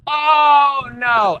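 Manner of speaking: high, drawn-out cry; outraged snap. Retold or imitated. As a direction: wail it in scandalised outrage. Repeats her outburst at pupil.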